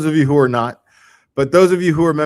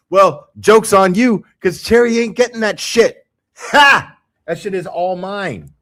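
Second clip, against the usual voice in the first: gloating; 6 semitones higher, 4 dB louder than usual. Pretend. Gloating over stolen treat.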